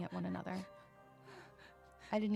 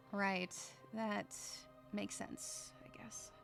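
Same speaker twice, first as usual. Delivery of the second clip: halting, fading to a soft mumble; hesitant concession; 8 dB quieter, much slower than usual. Acted. Narrator conceding to the kid, unsure mumble. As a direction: concede reluctantly, trailing into near whisper.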